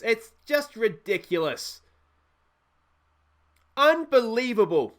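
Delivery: loud, high, slow disgusted yelling; can't believe they let that in; exasperated rant. Real, not acted. Keeps ranting after the goal.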